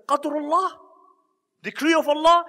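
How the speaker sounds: high, scornful echo of the caliph's words; mocking question. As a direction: sneer it back as a taunt. Hulagu mocks the caliph's fatalism.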